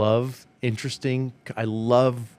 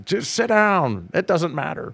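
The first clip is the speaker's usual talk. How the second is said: high, drawn-out dismissive order; mock scolding; 6 semitones higher than usual. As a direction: put jealous teammates in their place, playfully. Acting out mass text to teammates.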